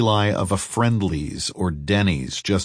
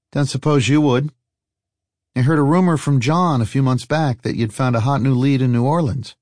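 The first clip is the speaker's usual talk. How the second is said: higher-pitched character voice; a light character lift, fishing for news; lightly voiced teasing traveller; 6 semitones higher than usual. Traveller probing an old friend about a rumor, lightly voiced, higher teasing.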